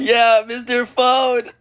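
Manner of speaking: high, loud, slow put-on voice; stretched, high cartoon shout; cartoon character cheer. Character echoes the name.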